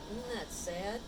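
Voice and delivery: plain reading voice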